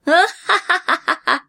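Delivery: high hammed-up laugh of glee; hearty gloating laugh. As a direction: burst into a greedy belly laugh. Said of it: "と、大笑いしたのでした".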